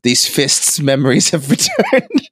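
slow, mock-solemn Cockney; a hint of Cockney, slowed right down; Cockney accent. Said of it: "his accent reverts back to Cockney".

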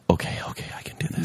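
soft, voiceless pep talk to oneself; whispered self-reassurance. Retold or imitated. Sick pupil talking himself through class, whispered self-reassurance. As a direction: whisper it, acting out barely coping.

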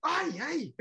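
high, hammed-up grumbling rage; mock fury. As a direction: play the fuming commentator for laughs. Mimics Pacheco fuming off air.

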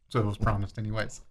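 normal talking voice

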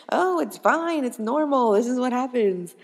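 high, lilting mock reassurance; imitating breezy doctor. Doctor telling shocked parents twins are normal, imitated breezy reassurance. Acts the doctor brushing off worry.

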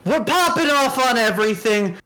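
booming circus announcer voice; ringmaster. Acted. Ringmaster-style podcast plug.

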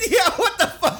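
high laughing exclamation; cracking up mid-word. Real, not acted. Laughing at the joke.

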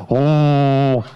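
deep, loud ship's horn; foghorn imitation. Shown as a demonstration. Comedian sounds a ship's horn at maritime people, booming foghorn. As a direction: blast out a long low foghorn.

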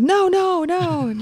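high breezy reassurance; high fake phone voice. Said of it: "And like".

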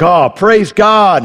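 husband thrilled to see the robber; relieved exclamation. Retold or imitated. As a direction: greet the robber with thankful relief. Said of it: "he's like".

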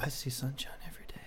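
whispered aside. Real, not acted. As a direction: breathe it out in a whisper.

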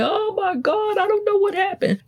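voicing a rattled kid who can't explain what happened; panicked kid. Kid home from school, panicked to parent, high startled whine.

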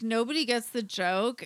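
usual speaking voice

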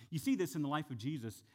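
ordinary talk, nothing marked

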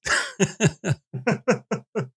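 high creepy villain cackle; evil laugh. Acted. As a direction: cackle like a sinister ringmaster. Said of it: "Now I'm creeped out".

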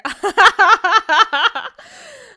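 big high burst of laughter; loud laugh. Real, not acted. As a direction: cracks up loudly. Laughing fit retold.

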